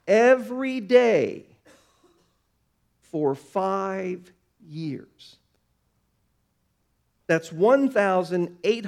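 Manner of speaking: preaching patience slowly; slow building emphasis. Acted. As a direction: stretch each phrase to feel the years.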